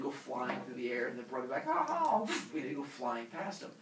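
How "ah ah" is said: high, louder dodging yelps; mock alarmed "ah". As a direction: yelp twice as a spear flies by. Brother dodging a flying spear.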